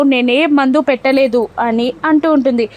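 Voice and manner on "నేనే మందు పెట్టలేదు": plain reading voice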